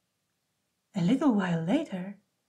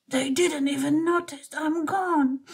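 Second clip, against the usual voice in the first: sniffling; 6 semitones higher than usual. A narrator's reading. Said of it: "he sniffed".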